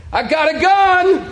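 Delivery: high-pitched mock-panicked threat; panicked warning. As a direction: call out scared to an intruder, hammed up. Scared, warning an imagined intruder.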